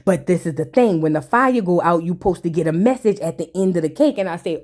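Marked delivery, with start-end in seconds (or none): none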